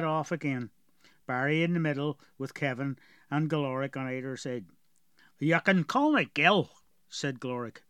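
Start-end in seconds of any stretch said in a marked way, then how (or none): 5.4-6.7 put-on gruff dwarf with a heavy rural accent; strong dwarf accent. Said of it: "Galoric had a very strong accent".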